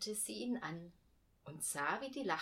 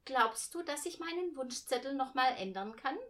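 put-on eager child; 6 semitones higher, 6 dB louder than usual. A narrator's reading. Girl asks mother eagerly.

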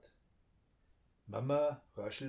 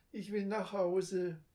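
put-on higher, slow timid child voice; scared little girl; 8 semitones higher than usual. A narrator's reading. Frightened girl clings to her brother's hand. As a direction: say it small and scared.

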